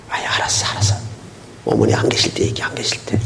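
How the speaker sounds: whispering so the parents won't hear; hushed whisper. Retold or imitated. Husband hushing his wife mid-fight, whispering. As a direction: hiss it under your breath, mock couple.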